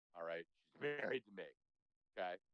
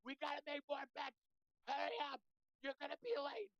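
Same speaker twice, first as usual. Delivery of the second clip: shrieking wife. Retold or imitated. Husband mimics wife shrieking to hurry to betting. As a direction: imitate the wife's frantic high-pitched shriek. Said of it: "Like, shrieking at me".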